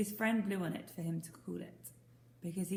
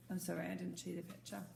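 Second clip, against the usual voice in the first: ordinary delivery, nothing marked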